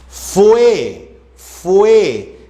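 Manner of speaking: FUE drawn out as one gliding syllable; one single syllable. Shown as a demonstration. Teacher showing that fue forms one syllable, drawn-out single syllable. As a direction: sound out FUE slowly, vowels joined. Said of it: "forma una sola sílaba".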